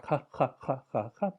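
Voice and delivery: "ha" said as a pure aspirated H, repeated; breathy H demo. Shown as a demonstration. Teacher isolating the H sound of hello. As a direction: push air through "ha, ha". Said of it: "the first consonant with the H sound".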